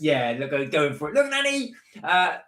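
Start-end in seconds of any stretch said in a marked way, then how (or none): 1.1-1.7 put-on high-pitched excited kid's call; mock child's shout, much higher. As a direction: call to Nanny like a little kid. Child calling out while pedalling go-kart.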